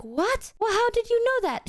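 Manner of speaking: high-pitched mock disbelief; squeaky-high put-on surprise; astonished friend. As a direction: imitate a baffled friend, voice jumping up. Imitating friend's astonished reaction.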